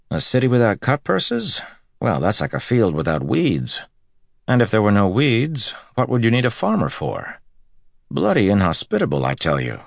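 banter with dry disgust; wry swordsman voice. Acted. Matt's character voice, holding forth.

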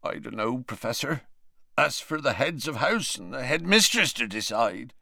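tearful croak, barely above a murmur. Acted. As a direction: croak it through tears.

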